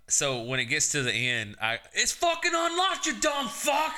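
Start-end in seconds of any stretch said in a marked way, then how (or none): 1.9-3.9 very high exasperated shout; high-pitched mock outburst of fury; re-enacted angry yell. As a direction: yell it like you've completely lost patience. Re-enacts outburst ending the video.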